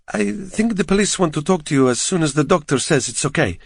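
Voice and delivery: put-on uneasy Eastern European nurse accent; reassuring, a hint of Eastern European accent. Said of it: "attempted a reassuring smile".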